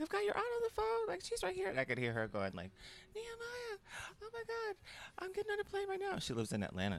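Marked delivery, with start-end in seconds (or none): 0.0-1.7 much higher, fast excited mock aunt; high quick relative on the phone; imitating aunt. Retelling aunt's call on speaker. 3.1-4.8 much higher panicky mock aunt calling a name; high alarmed relative voice; frantic aunt imitation. Retelling aunt's panicked call. 5.2-6.2 much higher mock aunt, stress on a; high insistent relative voice; frantic aunt imitation. Retelling aunt's panicked call.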